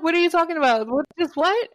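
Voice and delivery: baffled, pushing back on him; confused disbelief. Retold or imitated. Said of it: "I'm like".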